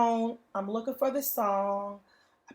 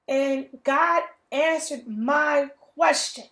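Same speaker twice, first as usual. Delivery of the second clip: louder, bursting with amazement; excited testimony; 2 semitones higher, 6 dB louder, slower than usual. Real, not acted. Telling her viewers how God answered, loud and amazed. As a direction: let the joy spill out in praise.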